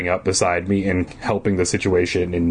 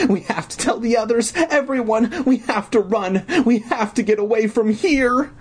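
put-on high, frantic little-girl voice; panicked pleading; 14 semitones higher than usual. Frightened girl pleads to flee.

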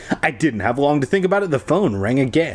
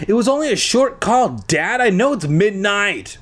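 calling out; 8 semitones higher than usual. Acted. Iris calling out to her dad.

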